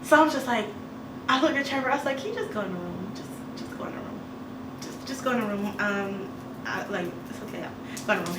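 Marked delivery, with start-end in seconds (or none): 2.2-3.1 low, hurried near-whisper; hushed urging. Retold or imitated. Hiding Trevor from neighbor. 3.2-4.2 urgent voiceless whisper. Retold or imitated. Whispering to Trevor. 4.8-8.4 soft, quick voiced undertone; hushed urging. Hiding Trevor from neighbor.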